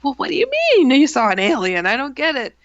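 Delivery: loud, high retold disbelief; incredulous. Narrator quizzes the roommate about the alien, incredulous.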